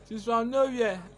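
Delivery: laughing through the reveal. Real, not acted. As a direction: crack up while confessing. Reveals prank while laughing.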